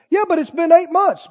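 lightly acted naysayer; nagging doubter. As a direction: needle them with a yes-but. Acted doubter heckling the victor.

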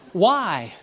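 usual speaking voice

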